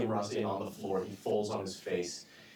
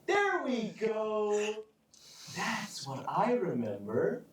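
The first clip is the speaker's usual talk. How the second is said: gleeful; 9 semitones higher, slower than usual. Acted. Villain satisfied.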